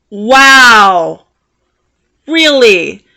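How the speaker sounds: loud, drawn-out sarcastic amazement; big incredulous exclamation; offended disbelief. Retold or imitated. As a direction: react to an insult with mock wonder. Retold reaction to being insulted.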